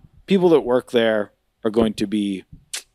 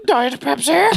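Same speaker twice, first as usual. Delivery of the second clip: high, hammed-up drink announcement; put-on waiter voice, pitched way up; waiter impression; 12 semitones higher, slower than usual. Waiter coming back with the drink, hammed-up high voice. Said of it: "he's like".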